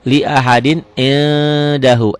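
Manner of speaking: drawn-out, chanted Arabic reading; measured tajwid recitation; slow Quran recitation. Shown as a demonstration. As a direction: recite it slowly, stretching each vowel. Teacher recites Quranic phrase.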